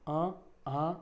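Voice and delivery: अ and आ said as drawn-out nasal vowels. Shown as a demonstration. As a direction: sound अ, आ through the nose. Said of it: "अनुनासिक में बस". (अ, आ को नाक से खींचकर बोलें)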